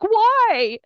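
loud, high imitation of a startled coach; astonished question. Head coach asking crying mother, retold loud astonishment. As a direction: blurt it high and loud, retelling his surprise.

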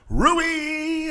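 "Rui" stretched out long and high; Rui drawn out long. Shown as a demonstration. PA announcer calling out a player's first name. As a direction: hold "Rui" long like an arena call. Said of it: "I just did Rui really long".

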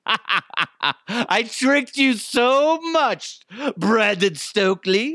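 high, laughing gloat, drawn out slowly; gleeful laughing. Real, not acted. Gloating to the audience about tricking Stokely, laughing. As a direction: cackle through it while gloating.

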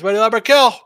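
high, loud mock battle cry; hyped shout. Reads a viewer's message aloud.

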